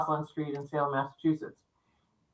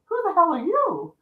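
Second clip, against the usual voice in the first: high mock-outraged comeback; indignant retort; 11 semitones higher than usual. Pipe-smoking man challenging the woman back, indignant. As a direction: throw the challenge back, voice pitched up. Intruder throws question back, retold.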